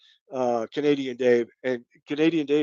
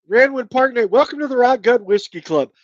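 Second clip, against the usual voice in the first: high, loud shout-out; real, high-energy welcome; excited welcome; 9 semitones higher, 6 dB louder than usual. Real, not acted. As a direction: announce a new member with a big, raised voice. Welcomes a new club member live.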